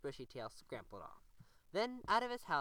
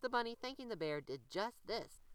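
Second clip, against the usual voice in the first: ordinary delivery, nothing marked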